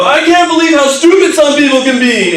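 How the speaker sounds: high-pitched indignant outcry; retold retaliatory yell. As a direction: re-enact yelling it for all to hear. Yells back at the angry woman.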